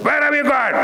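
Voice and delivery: high, sharp demand from a parent at the door; angry parent. Retold or imitated. Father at the door scolding his late child, high and sharp. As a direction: snap it out like a worried, angry father.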